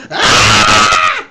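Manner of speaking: loud mock shriek; comic scream. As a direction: let out a startled yell. Naked man running scream.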